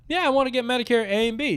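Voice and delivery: high, quick eager customer; prank caller. Retold or imitated. Retelling Christoph's prank caller voice.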